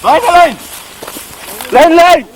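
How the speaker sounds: short, loud repeated command; urgent drill shout; shouted order. Real, not acted. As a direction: bellow the word twice to the line. Shouting orders during field drill.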